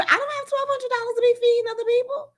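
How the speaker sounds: high, fast outraged squeal. Real, not acted. Ranting to viewers about the stolen money, high and fast. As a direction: spill indignation at the thieves. Outraged over fraud charges.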